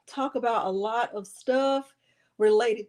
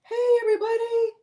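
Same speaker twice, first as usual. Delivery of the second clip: squealed greeting; 12 semitones higher, faster than usual. Shown as a demonstration. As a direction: squeal it out like an excited pup.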